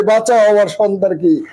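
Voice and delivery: ordinary delivery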